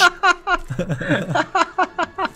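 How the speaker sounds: giggling fit high; high laugh. Real, not acted. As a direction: burst out giggling. Laughing after burp.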